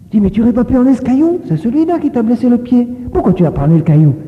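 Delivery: a schoolteacher's quick, disbelieving scolding; mock scolding teacher. Teacher scolds injured boy.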